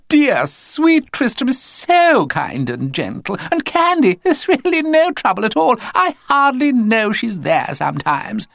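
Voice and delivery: high, loud syrupy sales pitch; gushing posh mother. A narrator's reading. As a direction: lay the sweetness on thick. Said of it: "she said to the voice".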